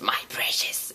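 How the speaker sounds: breathy whispered My precious; whispered Gollum line. Retold or imitated. Trailer's last line, Gollum whispering to the audience. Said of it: "the very last line of the trailer is".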